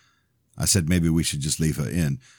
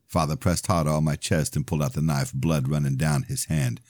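plain reading voice

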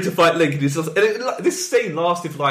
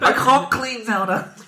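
faking frailty; 7 semitones higher, slower than usual. Retold or imitated. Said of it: "I love the voice acting".

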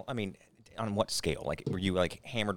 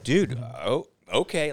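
surprised; 5 semitones higher, much slower than usual. Retold or imitated. Smokers' startled reply, retold.

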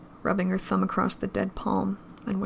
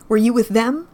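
accusing question; 3 semitones higher, 8 dB louder than usual. A narrator's reading. Girl accusing him.

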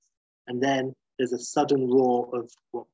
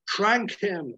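higher-pitched mock scream at guards; screamed order; 8 semitones higher than usual. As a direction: bark it out as a retold shouted command. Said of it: "Major screams at the guards".

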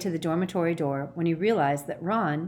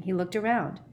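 ordinary delivery, nothing marked

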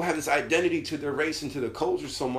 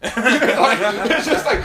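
high laughing giggle; 6 semitones higher, 6 dB louder, much slower than usual. Real, not acted. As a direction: crack up mid-sentence.

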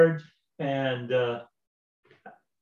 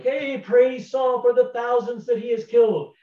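high, louder hailing cheer; crowd praise; 9 semitones higher, 3 dB louder than usual. Retold or imitated. Israelites greeting Saul in the camp, high cheering praise. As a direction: hail the returning king.

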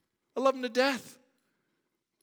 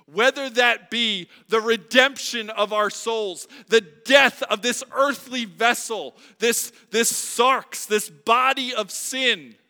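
louder, higher, with slowed heavy phrases; preaching at full force; 7 semitones higher, 14 dB louder than usual. Acted. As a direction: pour it out over the congregation.

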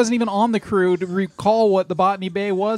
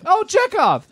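calling out; 14 semitones higher, 4 dB louder, slower than usual. Retold or imitated. Repeats the call for Chekhov.

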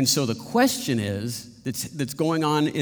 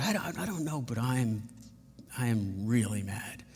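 quiet confession; 2 semitones lower, 7 dB quieter than usual. Retold or imitated. His retold quiet confession.

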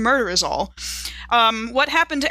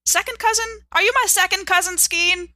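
calling out; 9 semitones higher than usual. Acted. Calling out through the wall.

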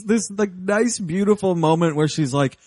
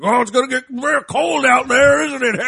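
higher, louder mocking taunt; taunting old man; 8 semitones higher, 5 dB louder than usual. Hermit taunting the kids left out in the cold, mockingly. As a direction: taunt them from inside the house. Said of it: "He's like".

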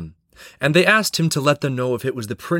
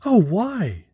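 high distressed princess cry; a young princess pleading, much higher; cartoon princess; 10 semitones higher than usual. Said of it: "said the princess in distress".

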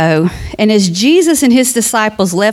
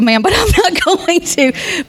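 laughing; 8 semitones higher than usual. Real, not acted.

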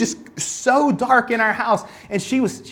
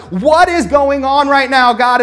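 mock panicked, louder and slow; alarmed at the audiobook, louder and slower; freaked out; 5 semitones higher, 6 dB louder, slower than usual. Pastor startled by audiobook naming him, mock panic. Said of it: "I was terrified".

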